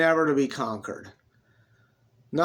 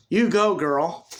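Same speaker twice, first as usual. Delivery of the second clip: a touch of sass, a little louder and higher; sassy cheer; 4 semitones higher, 5 dB louder than usual. A narrator's reading. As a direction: cheer her on with borrowed sass. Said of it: "the sassy one".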